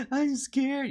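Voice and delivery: high, mock-tearful child voice; crying little boy. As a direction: imitate a frightened kid in tears. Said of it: "He's, like, crying the entire time".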